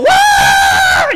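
high, loud running-round-church scream; wild scream. Retold or imitated. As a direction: imitate someone screaming for joy, full out. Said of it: "Just screaming".

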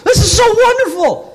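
high, loud, ecstatic shout; jumping for joy. Retold or imitated. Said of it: "jumping for joy".

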